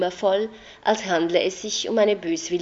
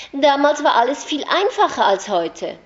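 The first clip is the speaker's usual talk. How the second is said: louder and higher, adamant; insistent raised voice; 7 semitones higher, 7 dB louder than usual. A narrator's reading. Anna insisting loudly to the narrator. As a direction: shut the argument down.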